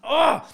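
loud, high fed-up groan; exasperated ugh. Retold or imitated. After finally clearing security, a loud exasperated ugh. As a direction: let out a frustrated ugh. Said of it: "and I just went".

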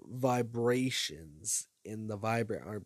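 ordinary delivery

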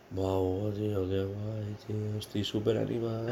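hushed, dragged-out fake enthusiasm; teasing a friend about his morning audio messages, mock cheerful; mock super-excited voice. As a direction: parody a friend's sleepy cheer at dawn. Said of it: "con esta voz".

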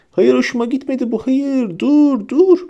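high, wailing plea to stop; rising panic. A narrator's reading. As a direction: whine in growing alarm. Ron being strangled by tentacles.

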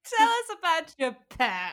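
repeating it through laughter; laughing. Real, not acted. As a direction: crack up while saying it. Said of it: "laughing repeat".

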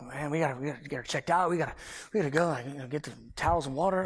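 low, soft, repetitive muttering; flustered muttering. Retold or imitated. Husband unsure what to do for wife in labor, quiet fluster. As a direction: mutter half-formed plans under the breath.

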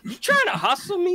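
high, loud mock outrage at the hustler; mom's indignant challenge. Mom confronting a flea market hustler, loud high indignation. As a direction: call him out, sharp and high. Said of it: "my mom's like".

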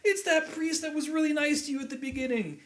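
high-pitched hammed-up blubbering; exaggerated crying squeal, played for laughs; mock tearful wail. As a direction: wail it through pretend tears. Imitates tearful outburst at film.

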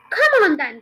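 loud rallying call; forceful, urging exclamation; eager cry. A narrator's reading. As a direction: call out to get someone moving. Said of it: "cried Mister Killy Cranky".

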